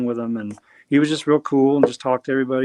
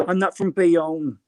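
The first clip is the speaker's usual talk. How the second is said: slow, higher, hammed-up New Jersey accent; 4 semitones higher, slower than usual. Guest jokes he is not from Bayonne, in a hammed-up New Jersey accent. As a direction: play a thick Bayonne local. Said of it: "talks like this up in New Jersey".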